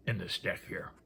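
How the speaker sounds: ordinary delivery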